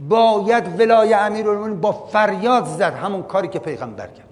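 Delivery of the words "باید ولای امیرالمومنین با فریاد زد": raised, higher-pitched proclamation; raised cry. Shown as a demonstration. Preacher tells listeners the guardianship must be cried out, raised cry. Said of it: "گفتن با فریاده".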